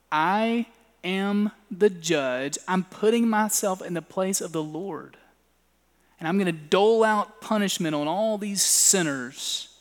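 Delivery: a hint of a pompous judge. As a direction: drag it out like a verdict. Mock self-appointed judge, slowed.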